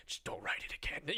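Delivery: unvoiced grudging mumble, imitated; bothered grumble. Dad grudgingly accepting the apology, bothered grumble. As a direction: say it under your breath, still put out. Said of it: "all bothered by it".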